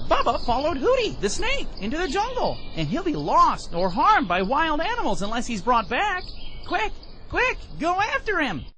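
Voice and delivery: very high-pitched bird voices sounding the alarm; urgent little birds. A narrator's reading. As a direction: cry the warning high up, like small birds. Birds urgently warn the dog.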